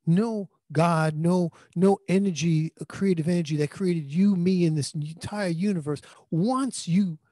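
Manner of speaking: ordinary talk, nothing marked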